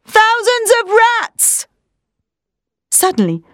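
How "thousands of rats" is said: high, drawn-out horrified cry; shouted alarm. A narrator's reading. Quincey sees the room swarm with vermin, shouted alarm. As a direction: stretch the warning out on a high pitch. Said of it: "Quincey shouted".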